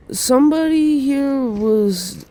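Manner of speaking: high, drawn-out hinting lead-in; imitated counselor. Counselor's pointed accusation, retold.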